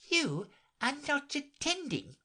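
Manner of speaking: high, severe mouse voice. A narrator's reading. Mouse scolding inattentive Alice, severe and high. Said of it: "said the mouse to Alice severely".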